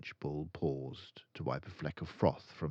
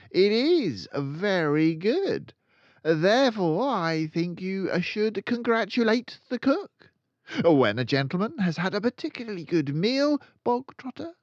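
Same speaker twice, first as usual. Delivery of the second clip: high, loud, ranting headmistress; furious Trunchbull; 9 dB louder than usual. Acted. Headmistress accusing a boy of stealing cake, loud rant. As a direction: bellow the accusation like a tyrant.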